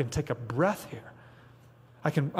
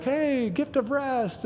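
high, slow, sing-song mock cheer; mock cheerful; 9 semitones higher, much slower than usual. Preacher parodies a cheery take on Sabbath, mock sweet. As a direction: sell "gift of rest" with fake sweetness. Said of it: "You're like".

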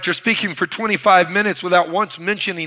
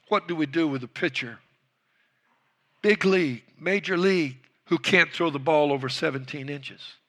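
softer, serious question after the shouting; grave, hushed question; 5 dB quieter than usual. Retold or imitated. Old coach asks the coaches about pitchers, softly. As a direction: drop the voice and make them think.

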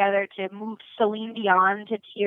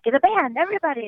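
higher-pitched imitation of a rallying diva; 7 semitones higher, faster than usual. Star rallying the room, lightly imitated high call. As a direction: lightly mimic a singer calling in the band. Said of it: "And then she's like".

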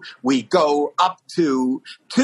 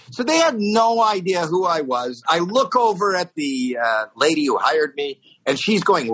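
usual speaking voice

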